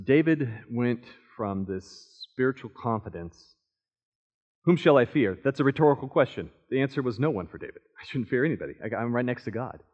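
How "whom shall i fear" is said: ordinary delivery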